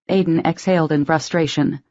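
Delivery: ordinary delivery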